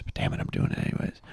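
unvoiced whispered aside; whispering. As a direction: whisper it to yourself. Aside to himself.

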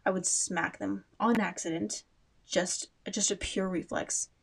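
ordinary talk

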